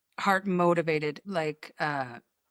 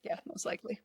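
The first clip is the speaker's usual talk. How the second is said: whispered aside; 14 dB quieter than usual. Real, not acted. Whispered aside about church.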